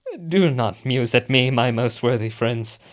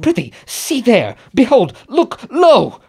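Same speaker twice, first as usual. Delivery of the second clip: higher, louder frightened cries; terrified cry; 10 semitones higher, 6 dB louder than usual. Acted. Macbeth crying out in terror at the ghost.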